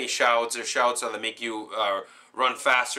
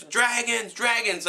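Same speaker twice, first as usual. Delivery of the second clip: panicked villagers crying out; 10 semitones higher, slower than usual. Retold or imitated. Villagers panic at dragon attack.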